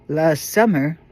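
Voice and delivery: ordinary delivery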